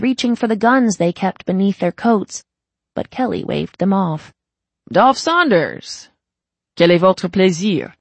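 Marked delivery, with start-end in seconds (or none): 4.9-7.9 put-on French accent. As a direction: glide into polished French. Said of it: "slipping into perfect French".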